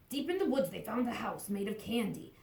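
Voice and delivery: usual speaking voice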